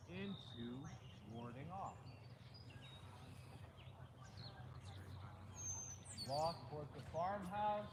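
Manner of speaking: calm, soft calling of moves; tai chi instructor. Acted. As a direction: call the form names gently. Calls out tai chi form moves.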